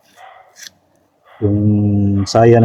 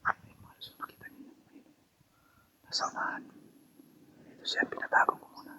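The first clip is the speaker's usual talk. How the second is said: soft unvoiced whisper; 23 dB quieter, faster than usual. Real, not acted. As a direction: keep it hushed, hiding. Still hiding, whispering. (pabulong na pagsasalita)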